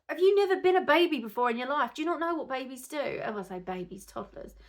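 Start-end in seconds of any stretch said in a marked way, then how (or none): 0.1-3.0 higher, loud, indignant rhetorical snap; indignant inner retort. Retold or imitated. Mum silently retorting to the staring old man, indignant and raised. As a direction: fire it at the old man in your head, outraged. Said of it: "And I thought".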